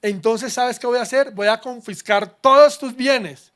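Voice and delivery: king shouting his threat; angry king. Acted. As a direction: bellow it as the enraged king. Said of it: "en tono bravo".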